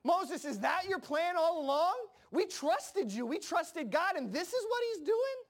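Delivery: high, incredulous accusation climbing at the end; acted-out indignant questioning; betrayed outrage. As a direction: confront him as if let down. People acted out accusing Moses.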